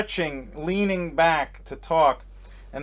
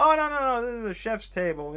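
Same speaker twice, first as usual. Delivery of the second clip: high-pitched delighted greeting; hammed-up welcome, pitched up without extra volume; imitated gushing hostess; 8 semitones higher, faster than usual. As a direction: gush like a hostess spotting a guest. Acting out hostess brushing off objection.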